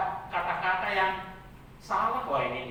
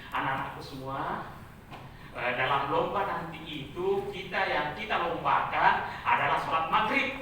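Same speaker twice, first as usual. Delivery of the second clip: low, softened teacher voice; religion teacher instructing; 7 semitones lower, 4 dB quieter than usual. Acted. Teacher coaching pupils for a prayer contest, measured instruction. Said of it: "guru itu mengatakan begini".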